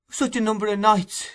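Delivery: high, gentle pleading; touch of tenderness. A narrator's reading. Nancy pleads tenderly with Sykes, gentle high voice. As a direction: soften it with tenderness. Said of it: "with a touch of woman's tenderness".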